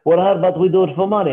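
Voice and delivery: hurried mock Russian accent; bad Russian accent. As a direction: imitate the Russian challenger, accent wobbling. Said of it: "This accent's getting a little bit worse".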